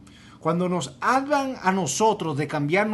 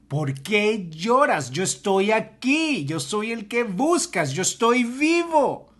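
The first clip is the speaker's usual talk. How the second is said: slow, a bit higher and louder, tender triumph; lightly voiced Jesus; 5 semitones higher, 4 dB louder, slower than usual. Jesus reveals himself to Mary, slow and joyful. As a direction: reveal yourself gently but gladly.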